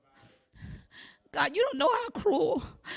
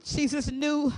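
usual speaking voice

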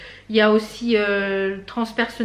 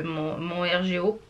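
real hoarse voice, a little softer; hoarse and worn out; 5 semitones lower, 7 dB quieter than usual. Real, not acted. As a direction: speak through a worn-out voice. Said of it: "j'ai la voix toute cassée".

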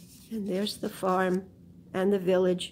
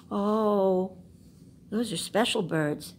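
usual speaking voice

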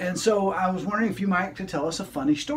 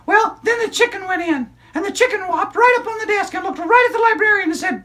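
put-on high, bright child puppet storyteller; squeaky kid character excitedly telling a story; high-pitched puppet girl narrating; 14 semitones higher, 6 dB louder than usual. Puppet continues.

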